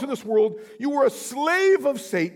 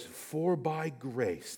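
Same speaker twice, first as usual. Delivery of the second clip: slow, much softer reading of the verse; 8 semitones lower, 10 dB quieter, much slower than usual. Acted. Preacher reading the verse to his church, slow and soft. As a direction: lean into each word slowly.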